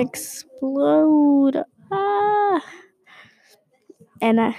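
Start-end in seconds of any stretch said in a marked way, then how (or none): 1.9-2.6 a much higher, airy ah of overwhelm; excited gasp. Retold or imitated. Child meeting grandparents, gasping with excitement. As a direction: gasp as if your heart might burst. Heart about to explode.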